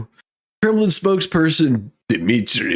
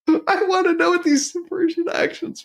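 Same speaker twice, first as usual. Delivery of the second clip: laughing; 13 semitones higher than usual. Real, not acted. Amused by the news wording.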